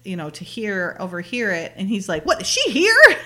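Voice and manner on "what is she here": high, loud mock indignation; incredulous retold outburst with a laugh; a little ticked off. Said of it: "he's getting a little ticked off".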